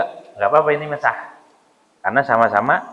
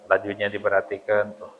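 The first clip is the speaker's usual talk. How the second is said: ordinary talk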